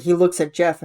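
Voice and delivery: normal talking voice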